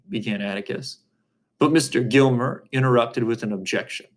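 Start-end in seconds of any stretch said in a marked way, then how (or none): none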